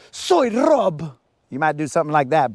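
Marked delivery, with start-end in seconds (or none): 0.1-1.2 dramatic Spanish intro. Retold or imitated. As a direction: announce yourself like a telenovela hero. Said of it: "You'll be like".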